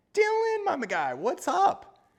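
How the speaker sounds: greeting Dylan in the chat with delight; excited greeting. Real, not acted. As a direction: call out to a friend in chat.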